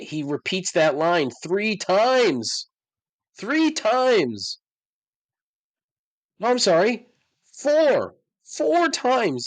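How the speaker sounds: rising disbelief, nearly shouting; exasperated. Real, not acted. Exasperated over the repeated line.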